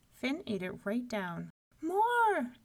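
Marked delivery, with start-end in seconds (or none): 1.8-2.5 put-on high, loud baby demand. A narrator's reading. Finn as baby wanting another loaf, baby voice. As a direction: demand it like a greedy infant. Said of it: "said the baby".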